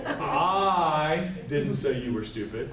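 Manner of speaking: slow, with "I" stressed and a rising end; slow stressed take. Shown as a demonstration. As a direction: draw out "I didn't say you were stupid". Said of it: "by moving what word I'm emphasizing".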